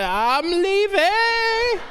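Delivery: devil voice. Acted. Devil answering the exorcist priest, slow theatrical wail. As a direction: stretch it out as a hammed-up demon. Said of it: "I put on my best devil voice".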